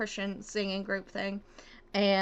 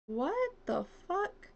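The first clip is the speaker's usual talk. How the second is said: slow, high-pitched bewilderment; baffled; 8 semitones higher, 5 dB quieter, slower than usual. Real, not acted. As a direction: draw it out high, rising into a question. Baffled by the game's camera.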